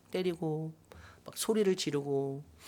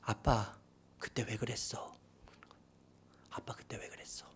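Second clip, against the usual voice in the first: low, whispered question, fading away; hushed, wounded child; hurt son asking; 6 semitones lower, 13 dB quieter than usual. Retold or imitated. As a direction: quietly ask your father why. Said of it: "제 아들이 저한테".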